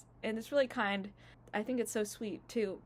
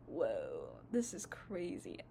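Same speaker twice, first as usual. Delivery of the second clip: awed whisper; 12 semitones lower, 5 dB quieter, slower than usual. Retold or imitated. Awed quote of own reaction.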